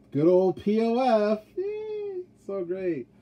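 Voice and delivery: high, slow mock enthusiasm, squealing the yay; sarcastic cheer. Real, not acted. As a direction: fake delight to mock a bad dating app. Sarcastic cheer about bad app.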